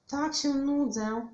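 high, loud, drawn-out moan; complaining whine. A narrator's reading. Bored Witek moans to grandma, whining. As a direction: whine it out slowly. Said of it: "poskarżył się Witek".

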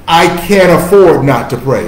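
I can't AFFORD not to pray, leaning on afford; firm declaration. Shown as a demonstration. Preacher giving his congregation a daily declaration, firm and loud. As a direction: declare it firmly, punch afford. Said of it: "I'll say it slow".

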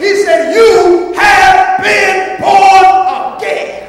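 high, loud preaching with drawn-out phrases; full-force preaching. Real, not acted. Preacher proving new birth to congregation, full-force preaching. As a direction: hammer each name and phrase home.

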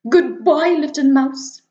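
a snake caught off guard; alarmed snake. Acted.

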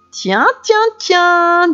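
put-on high, teasing little girl; drawn-out mocking tease; girl who has found the hidden wolf, teasing him; sly girl voice. A narrator's reading. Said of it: "dit le Petit Chaperon Noir".

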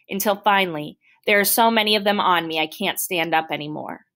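ordinary delivery, nothing marked

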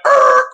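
loud, low imitated screech; screech-to-a-halt noise. Speaker imitates people turning round at a slur, abrupt screech. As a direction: make a loud, abrupt "errr" of alarm. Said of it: "we turn around like".

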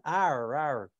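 low, effortful "Arr", said as a drill; practising the R sound. Shown as a demonstration. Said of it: "I just can't say that word".

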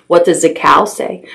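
plain reading voice

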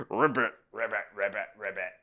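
short, playful frog croaks; frog ribbits. Pretend. Reader showing children the green frog, playful ribbits.

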